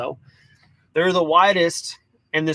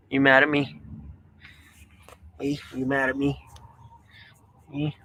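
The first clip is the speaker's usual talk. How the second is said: tender; 3 semitones lower, 8 dB quieter, faster than usual. Real, not acted. Soft baby talk to dog.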